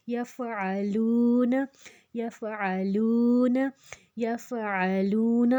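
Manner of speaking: یفعلون drawn out, ain squeezed from the throat; throaty ain. Shown as a demonstration. Teacher warns against hamza, throaty ain in slow یفعلون. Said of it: "حلق سے نکالنا ہے".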